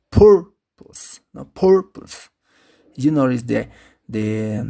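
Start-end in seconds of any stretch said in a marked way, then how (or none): 0.1-1.2 "purpose" with a high, loud, long first syllable; first-syllable stress. Shown as a demonstration. Teacher points out the marked first syllable, stressing it hard. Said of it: "in the first syllable". 1.4-2.3 "Purpose" with the first syllable pitched high; first-syllable stress. Shown as a demonstration. Teacher repeats the example word, first syllable stressed. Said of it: "in the first syllable".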